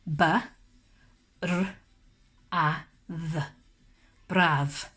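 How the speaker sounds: letter by letter B, R, A, V, then Braf; sounding out words. Shown as a demonstration. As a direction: spell out the sounds slowly, then blend Braf. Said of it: "Let's sound it out together".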